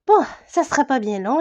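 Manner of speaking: ordinary talk, nothing marked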